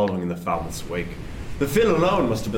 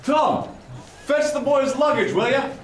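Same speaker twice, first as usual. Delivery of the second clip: raised, high call across the deck; calling out an order; 12 semitones higher than usual. Acted. Teague calling to a sailor to fetch the luggage, raised call. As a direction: shout the order to a deckhand.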